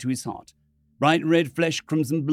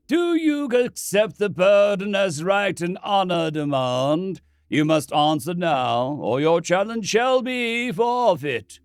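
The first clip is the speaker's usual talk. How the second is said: solemn priest; 5 semitones higher, 4 dB louder, slower than usual. Acted. Priest demands answer slowly.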